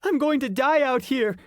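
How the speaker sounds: put-on high squeaky hopeless boy; a boy sure he will die; despairing kid. A narrator's reading. Ian despairing.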